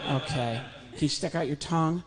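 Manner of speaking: usual speaking voice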